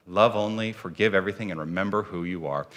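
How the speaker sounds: low, hushed repeat of the creed; soft restatement. Real, not acted. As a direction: let it settle softly in one flowing phrase. Repeats the mission statement as reflection.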